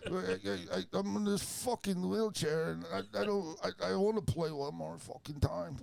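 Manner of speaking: put-on high, mumbling old rocker voice; [name]. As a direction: stammer it like a worn-out rock star. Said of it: "what's [name] done to you, man".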